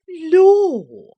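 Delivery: high, loud startled exclamation; astonished nurse. A narrator's reading. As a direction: gasp it out like a shocked old nurse.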